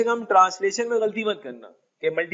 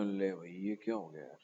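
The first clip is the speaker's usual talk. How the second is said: puzzled mutter; 11 semitones lower, 15 dB quieter than usual. Retold or imitated. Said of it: "आपने कहा".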